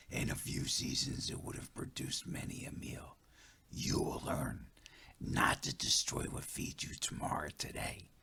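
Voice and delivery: soft hushed elder voice; quiet, patient whispered lesson; whispering spirit voice. Acted. Running Bear long quiet lesson.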